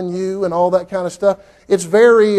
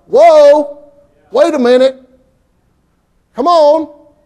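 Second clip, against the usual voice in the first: high, loud startled cry of alarm; startled whoa; 8 semitones higher, 8 dB louder, much slower than usual. Retold or imitated. Preacher reacting to the Bible reading, startled high cry.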